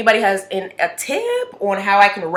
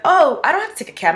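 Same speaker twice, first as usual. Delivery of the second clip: eager; 10 semitones higher, 4 dB louder, faster than usual. Retold or imitated. Retold eager self.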